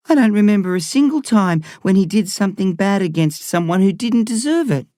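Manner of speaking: put-on high-pitched boy voice, insisting; earnest kid. A narrator's reading. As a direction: voice a kid standing up for Gus, pitched well up.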